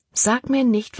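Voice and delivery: indignant, pointed retort; defiant. A narrator's reading. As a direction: refuse to be ordered about. Defies Caleb's order.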